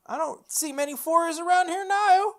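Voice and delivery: high, loud mock grumble on "I don't see many foreigners around here now"; hammed-up old man. Shown as a demonstration. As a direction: ham the repeat as a cranky old-timer. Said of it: "repeated as mock take".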